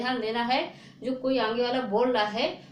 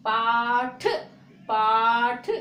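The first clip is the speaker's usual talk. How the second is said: पाठ said louder and punched; stress on पाठ; 5 dB louder, much slower than usual. Shown as a demonstration. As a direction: hit पाठ firmly, twice. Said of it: "देखो इसमें थोड़ा जोर".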